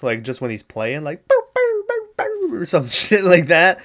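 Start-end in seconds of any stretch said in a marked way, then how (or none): 1.3-2.6 high, playful boop noises; weird child noises. Retold or imitated. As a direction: imitate a creepy kid playing, very high. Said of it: "he makes some weird noises".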